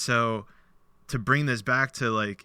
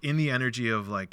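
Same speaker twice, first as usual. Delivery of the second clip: ordinary talk, nothing marked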